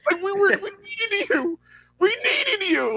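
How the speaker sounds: high, pleading "we needed you"; desperate plea. Retold or imitated. Batman begs the Martian Manhunter, high and pleading. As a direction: beg like an abandoned teammate. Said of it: "acted plea to Manhunter".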